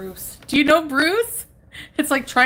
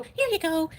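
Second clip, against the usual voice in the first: chicken voice; 8 semitones higher, slower than usual. Retold or imitated. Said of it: "she'll go".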